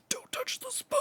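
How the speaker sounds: tiny, very high strained squeak; hushed, squealed self-warning; suppressed freak-out. Retold or imitated. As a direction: squeak it softly, holding panic in. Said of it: "trying not to freak out".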